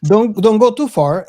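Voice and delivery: usual speaking voice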